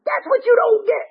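very high-pitched scolding impression; Rusty. Old-timer poking a member's chest, high-pitched scolding. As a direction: drive the point home in a squeaky put-on voice. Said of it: "he said it like this".

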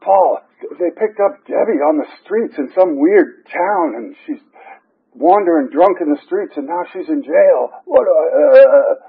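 high-pitched, hammed-up distraught phone call; retold panic. Sponsee phoning sponsor about a jailed friend, mock panic.

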